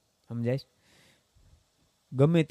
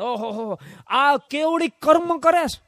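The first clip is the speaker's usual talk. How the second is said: scoffing; 10 semitones higher, slower than usual. Acted. Jain scoffing at digging monks.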